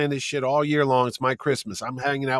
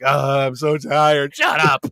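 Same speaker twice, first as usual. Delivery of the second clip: tired grumbling; 5 dB louder, slower than usual. Retold or imitated. Exhausted husband answering his wife, acted.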